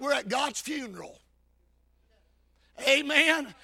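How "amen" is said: ordinary delivery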